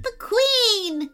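high-pitched cry of alarm; panicked bee character. A narrator's reading.

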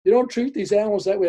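high, loud scolding of the woman. Retold or imitated.

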